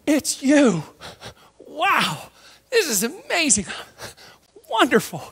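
gasp, then high delighted exclamations; acted overjoyed astonishment; awed amazement. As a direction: marvel in separate bursts of joy. Disciples overjoyed at recognizing Jesus.

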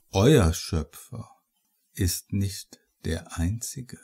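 low, menacing killer voice; cold, amused murderer; sinister killer. Acted. Villain speaks to dying narrator.

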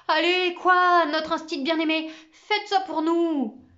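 high, loud begging plea; pleading. Acted. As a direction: beg on your knees. Kneeling, begging the teacher.

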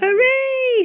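high, loud bunny hooray; cheer. Acted. Boo cheers for carrots, high and loud. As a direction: cheer like a delighted little rabbit.

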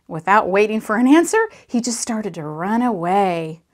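ordinary delivery

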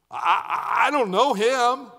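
stammered, rising frightened denial; panicked denial. Retold or imitated. Peter caught by the fire, a stammered frightened denial. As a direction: blurt it out scared.

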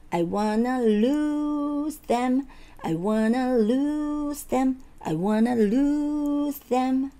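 "lose" stretched long and high; slow line swelling into a long "lose"; stress on LOSE. Shown as a demonstration. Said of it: "loseのところ強いですね". (「lose」を高く長く強調)

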